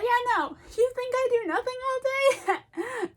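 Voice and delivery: high, loud cartoonish indignation; mock indignant protest. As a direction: protest loudly as an affronted cat. Voices Gumby cat's protest.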